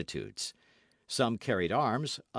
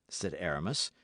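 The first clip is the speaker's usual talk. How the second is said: usual speaking voice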